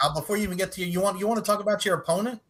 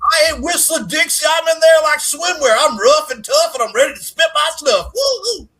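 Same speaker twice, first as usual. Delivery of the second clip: loud, high mock hillbilly shouting; hammed-up boasting; 8 semitones higher, 8 dB louder than usual. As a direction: holler it like a hyped-up wrestling promo, ending in whoops.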